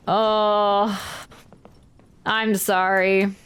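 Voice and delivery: ordinary delivery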